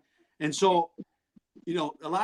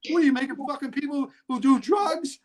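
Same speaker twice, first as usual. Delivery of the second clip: higher, mock-indignant protest; retold indignant heckler; 7 semitones higher, 4 dB louder than usual. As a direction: imitate an offended bystander. Heckler behind him, retold.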